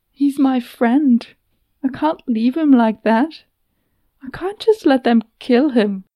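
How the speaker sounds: upset plea. Acted. Nedek pleading for her friend.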